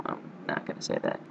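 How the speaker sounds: ordinary talk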